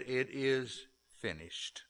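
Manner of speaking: quiet dying words; hushed last words. Acted. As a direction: let it fall to almost nothing.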